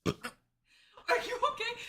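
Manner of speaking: high, slow, laughing through it. Real, not acted. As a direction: ask while cracking up. Laughing at friend gagging.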